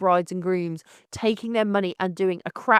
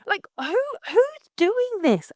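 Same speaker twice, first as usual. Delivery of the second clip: pitched way up and dragged out slowly; exasperated disbelief; 10 semitones higher, much slower than usual. Real, not acted. Ranting to listeners about photographers who let brides down, high and slow in disbelief.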